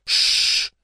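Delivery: long unvoiced shush; sharp "shh" through the teeth; hushing shush. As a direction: hush them urgently. Giant hushes the shouting girl.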